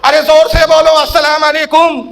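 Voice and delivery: high, loud call then slow salaam; mocking loud demand. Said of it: "یہ تماشے بازی".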